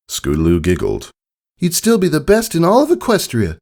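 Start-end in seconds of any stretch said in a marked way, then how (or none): 1.6-3.6 put-on high young filly, full of affection; a kid telling dad he's the best; adoring filly. A narrator's reading. Filly praising her father at breakfast, high and adoring.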